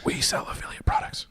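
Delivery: soft secret whisper. Real, not acted. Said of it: "Why are we whispering?".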